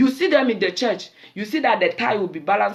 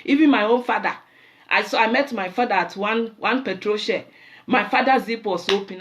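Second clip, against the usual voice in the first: usual speaking voice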